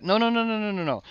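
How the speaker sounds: high, loud string of no's; emphatic no. Real, not acted. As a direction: shut the idea down hard. Rejecting the social media comment.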